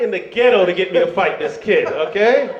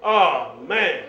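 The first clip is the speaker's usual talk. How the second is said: ordinary talk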